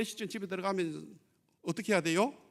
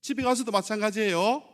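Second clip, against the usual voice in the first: ordinary delivery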